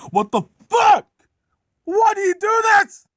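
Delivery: high, loud, stretched-out screaming; furious scream. Acted. Gordon failing on the turntable, furious scream. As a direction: scream in helpless rage. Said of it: "Gordon was really mad".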